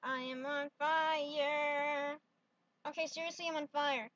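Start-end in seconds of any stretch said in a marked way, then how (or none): 0.0-2.2 drawn-out deadpan; very slow, dry statement. Pretend. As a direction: say it slowly, unbothered. Calmly announcing being on fire.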